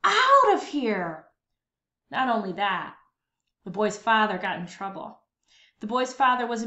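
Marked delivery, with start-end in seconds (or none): 0.0-1.3 higher, louder, slow scold; grown-ups scolding. Acted. Grown-ups chase the boy and his ukulele off, scolding. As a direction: shoo the boy away. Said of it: "the grown-ups would say".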